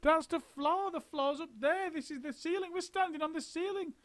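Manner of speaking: put-on high, frantic, fading old woman; panicked Missus Twit. A narrator's reading. Missus Twit panicking to husband about the ceiling. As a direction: babble in rising panic.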